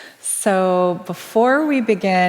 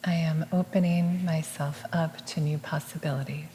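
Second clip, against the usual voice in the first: soft, measured meditative repeat; hushed affirmation, evenly spoken; affirmation echo; 9 dB quieter than usual. Real, not acted. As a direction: repeat it gently as a quiet response. Echoes the affirmation.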